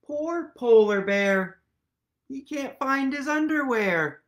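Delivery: ordinary delivery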